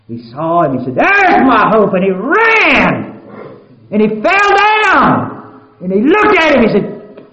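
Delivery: loud, high, chanted preacher's roar; sustained high loud gospel chant; full-force preaching. Real, not acted. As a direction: lift the congregation with a rising, ringing preach.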